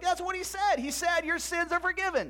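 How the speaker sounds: high, emphatic repeat; emphatic insistence. Acted. Zeb insisting to his doubting friend, emphatic. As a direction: insist on it, pitch held high.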